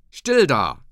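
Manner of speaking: loud, abrupt shout for silence. A narrator's reading. Ole Hensen silencing the quarrelling room, shouting. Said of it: "schrie er".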